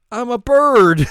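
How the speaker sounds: high, very loud silly cry; blurted confession. Retold or imitated. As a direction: belt it out high as an absurd boast. Acted absurd blurted confession.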